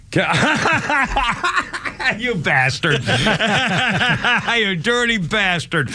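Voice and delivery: laughing as he scolds a prankster friend; playful laughing jab; laughing insult. Real, not acted. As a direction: chuckle it out, mock-outraged.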